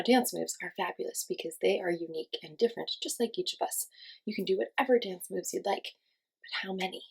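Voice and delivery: ordinary talk, nothing marked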